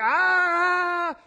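loud, high "Ah!" of triumph; spring the trap with a shout; gotcha cry. Pretend. Questioners pounce on the answer.

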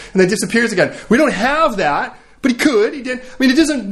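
ordinary delivery

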